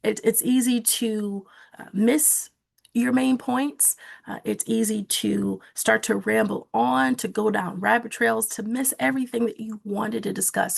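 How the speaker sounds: ordinary talk, nothing marked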